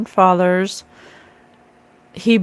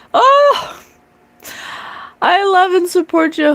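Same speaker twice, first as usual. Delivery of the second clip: much higher, emotional outpouring; gushing; 9 semitones higher than usual. Real, not acted. Vlogger answers a viewer's kind words, gushing and high. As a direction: pour out affection.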